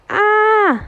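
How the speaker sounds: sharp high yelp of pain, loud; cry of pain. Acted. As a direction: yelp as a thorn hits the foot. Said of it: "hij schreeuwt het uit". (hoge pijnkreet)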